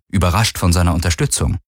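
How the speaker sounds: usual speaking voice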